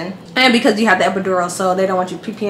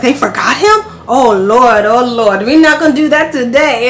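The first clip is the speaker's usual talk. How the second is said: louder, sassy Oh Lord outburst; indignant exclaiming; 2 semitones higher, 5 dB louder than usual. Retold or imitated. Anesthesiologist reacting to the forgotten husband, loud indignant. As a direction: scold the staff in mock outrage. Anesthesiologist outraged on her behalf.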